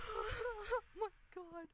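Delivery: high, slow, half laughing, half crying; fearful laugh-cry. Real, not acted. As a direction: laugh out the terror. Laughing fright after jump scare.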